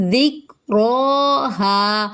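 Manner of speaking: heavy ra in ذکرہا, the ha drawn out; tajweed recitation. Shown as a demonstration. Teacher reciting the word, very slow. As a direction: stretch the final ha of ذکرہا. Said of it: "را پر ہے اور کھڑا زبر ہے".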